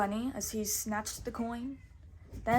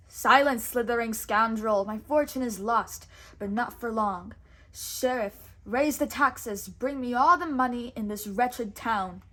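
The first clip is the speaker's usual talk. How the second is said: louder, cruel angry orders; cruel snarl; 6 dB louder than usual. Acted. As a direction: snarl at the snake and the sheriff. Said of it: "Prince John snarled cruelly".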